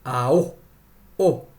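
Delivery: "Ao" stressed on the final O, then "o" alone; "Ao", pause, short isolated "o"; stress on final syllable. Shown as a demonstration. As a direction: punch the last vowel of "Ao", repeat "o". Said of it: "ñe'ẽ pehẽ pahápe avei".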